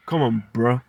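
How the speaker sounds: usual speaking voice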